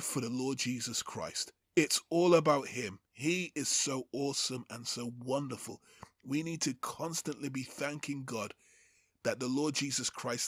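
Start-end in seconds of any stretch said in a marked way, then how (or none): none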